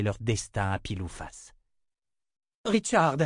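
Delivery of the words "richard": high, loud cry of Richard; cry out a name. Acted. Verna calling out to Richard in a high cry. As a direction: call out to him sharply. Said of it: "s'écria Verna".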